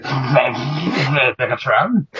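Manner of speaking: very slow, drawn-out robot name; imitating Starscream spitting on the mic; spitty Starscream imitation. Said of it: "He's like".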